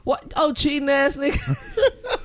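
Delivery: very high, hammed-up outraged call; mock announcer losing it over cheating; excited announcer. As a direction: shriek like a biased commentator.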